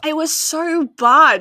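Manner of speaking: exasperated. Real, not acted. Exasperated at the pointless spin.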